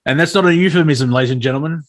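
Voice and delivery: ordinary talk, nothing marked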